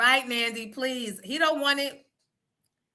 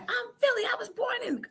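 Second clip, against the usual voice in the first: high, whispery mock kid voice; son insisting; 5 dB quieter than usual. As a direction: imitate the son's plea, pitched up. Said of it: "He's like".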